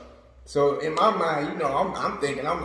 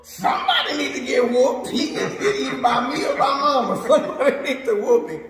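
put-on boy egging mom on to whoop his friend; instigating shout; 7 semitones higher, faster than usual. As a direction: stir up trouble for a friend. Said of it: "I was instigating".

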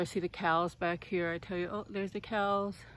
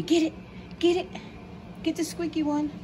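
very high squeaky play voice; excited pet talk; 11 semitones higher than usual. Real, not acted. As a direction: hype the dog up, high and bright. Playing with dog, high.

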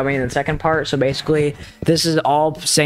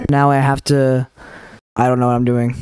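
ordinary talk

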